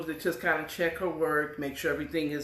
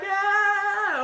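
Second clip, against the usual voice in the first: high happy shriek; excited scream; 14 semitones higher, 6 dB louder than usual. Retold or imitated. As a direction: squeal for joy. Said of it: "she's screaming".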